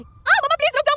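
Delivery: very high, very loud screaming plea; terrified scream. A narrator's reading. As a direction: scream for your mother in panic. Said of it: "सनम जोर से चिल्लाई".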